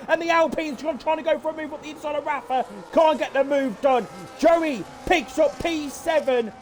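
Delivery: high-pitched, racing excited call; frantic commentary. Real, not acted. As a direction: shout every position change. Six cars battling at the line.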